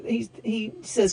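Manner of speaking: usual speaking voice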